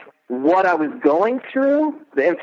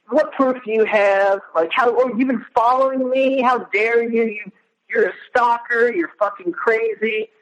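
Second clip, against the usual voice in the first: higher, mock-indignant accusing voice; imitating wife's outrage; 7 semitones higher than usual. Husband mimics his wife calling him a stalker, mock outrage. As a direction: replay her shrill-ish disbelief, lightly acted.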